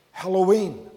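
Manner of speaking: ordinary delivery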